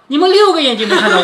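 loud, fast, giggling sneak-up line; spring it on them with a laugh; laughing playful quote. Retold or imitated. Teacher acting Baoyu surprising the girls, laughing loudly.

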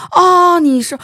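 high, loud puzzled 啊，你是？; a doctor who can't place a face; mock puzzled question. Doctor puzzled in retold scene.

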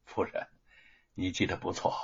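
soft, low, rueful reply; subdued rueful acknowledgment; wry, bitter. A narrator's reading. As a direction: answer quietly with a faint bitter smile. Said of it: "陈龙微微苦笑".

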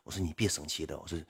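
very soft, low placating; retold soothing. Streamer pleading with an offended supporter, soft low placating. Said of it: "一顿哄".

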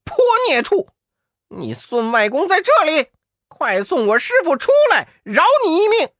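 尖声高喊的悟空叫骂; 冲妖怪破口大骂. Acted. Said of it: "悟空上前骂道". (怒声大骂)

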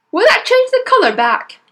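high, loud, worried question; anxious father. Acted. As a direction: ask desperately, clinging to hope. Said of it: "the father asked anxiously".

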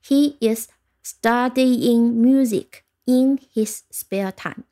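slow, evenly spaced words; model sentence reading. Shown as a demonstration. Teacher giving learners an example sentence, slow model reading. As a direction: read it out slowly for learners. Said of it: "我们听一个例句".

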